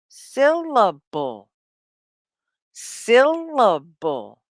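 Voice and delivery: "Syllable" stretched slowly into separate beats. Shown as a demonstration. English teacher has learners repeat a hard word, slow syllable by syllable. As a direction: draw out each part of "Syllable", slowly. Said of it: "Can you say it?".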